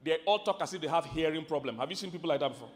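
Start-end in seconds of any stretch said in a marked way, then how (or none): none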